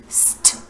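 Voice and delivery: "St" breathed out as two separate sounds, s then t; teacher sounding out "St" without voice; whispered st sound. Shown as a demonstration. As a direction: hiss the s then tap the t. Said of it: "Can you say the sound that S T makes".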